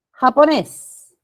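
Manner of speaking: Japonés slow and loud, weight on NÉS; Japonés said as ja-po-NÉÉS; stressed final syllable. Shown as a demonstration. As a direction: project Japonés, landing on the last syllable. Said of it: "Sílaba tónica también por aquí".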